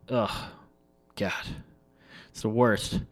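usual speaking voice

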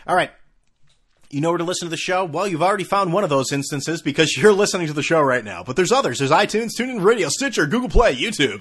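loud, high-pitched mock radio host; fake radio voice. Host plugging the show to listeners, fake radio voice. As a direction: belt it out like a hammed-up radio announcer. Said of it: "You like my fake radio voice?".

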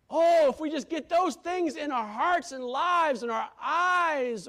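loud, high, slow shouted praise; ecstatic praise. Real, not acted. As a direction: lift God up with every line.

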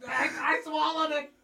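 high panicked yelp; shocked shout. Real, not acted. Just swallowed a disgusting bean.

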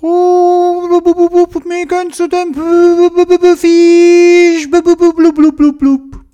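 high garbled words broken by blubs; underwater gurgling voice. A narrator's reading. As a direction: talk through water, gurgling and high. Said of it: "tönt alles ein bisschen anders".

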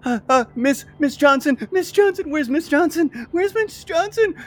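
high, loud panicky calling; panicked child. Acted. GM voicing the lost girl calling for her teacher.

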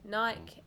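Nike rhyming with bike; "Nike" with a silent e; one-syllable Nike. Real, not acted. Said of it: "I love how you pronounce Nike".

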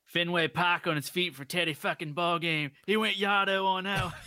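hammed-up bad Boston accent quoting the movie. Host quoting the Teddy Ballgame line in a Boston accent. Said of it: "mixing, like, all kinds of accents".

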